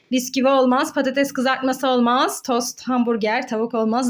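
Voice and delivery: usual speaking voice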